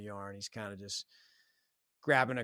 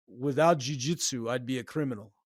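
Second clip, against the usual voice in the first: imitated deep-voiced man; 2 semitones higher, 8 dB louder than usual. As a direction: lightly imitate an accented training partner's pronouncement. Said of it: "he had a deep voice".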